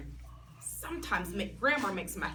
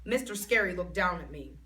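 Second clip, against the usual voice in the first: ordinary delivery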